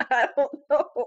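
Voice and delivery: laughing through the words; can't stop laughing while answering. Real, not acted. Reading the silly fanfic, laughing out I don't know.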